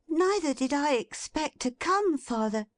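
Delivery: put-on high bright girl's voice, cried out; a daughter surprising her father, cheerful; Meg crying out. A narrator's reading. Meg greets her father, high and eager. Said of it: "cried the girl".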